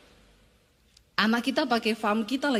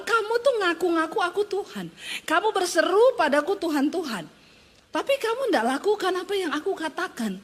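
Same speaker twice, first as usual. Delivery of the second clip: God's rebuke, pitched higher; God rebuking; 6 semitones higher than usual. Acted. God rebukes Christians who call him Lord but disobey. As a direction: scold them as God for empty words. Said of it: "Tuhan bilang".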